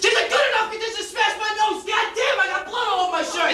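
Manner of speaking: high, heated complaint; outraged outburst; indignant shout. Acted. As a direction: rant over the injury. Indignant over smashed nose.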